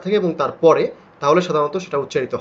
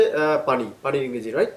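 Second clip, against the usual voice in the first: usual speaking voice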